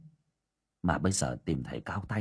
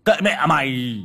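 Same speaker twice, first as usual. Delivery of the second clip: angry snap; 5 semitones higher, 8 dB louder than usual. Acted. Father snaps at mad son.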